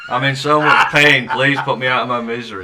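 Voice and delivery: hammed-up plea through laughter; mock begging. Begging a training partner to end it, mock begging. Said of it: "wanting me to beg him to kill me".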